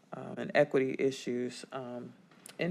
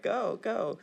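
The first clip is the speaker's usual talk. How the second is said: eager cheer; 8 semitones higher than usual. Retold or imitated. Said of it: "we were all waiting, like".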